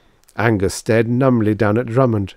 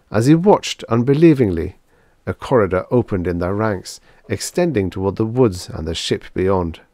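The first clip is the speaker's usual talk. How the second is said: usual speaking voice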